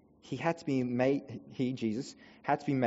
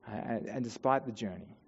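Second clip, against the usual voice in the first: usual speaking voice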